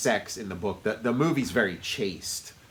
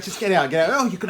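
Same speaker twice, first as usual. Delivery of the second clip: fawning baker; 8 semitones higher, 6 dB louder, faster than usual. Retold or imitated. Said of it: "he's like".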